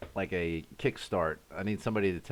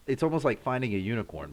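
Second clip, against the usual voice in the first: normal talking voice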